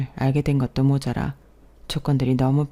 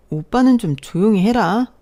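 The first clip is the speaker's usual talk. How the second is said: loud, high, annoyed retort; irritated sister; 6 semitones higher, 7 dB louder than usual. Acted. Sister to brother mocking her boyfriend's age, irritated. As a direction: shut a sibling up with raised voice.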